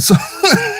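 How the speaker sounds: short high giggle. Retold or imitated. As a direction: giggle as the sweet lawyer. Laugh inside quote.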